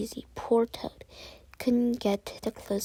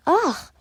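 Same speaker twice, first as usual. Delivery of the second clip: disgusted ugh; 8 semitones higher, 8 dB louder than usual. A narrator's reading. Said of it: "she cried".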